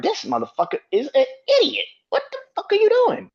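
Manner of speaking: high shriek of disbelief at the end; retold outburst. Fan reliving yelling at the TV, high incredulous retold yell. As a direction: hurl it at the screen, pitch flying up. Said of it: "I'm like".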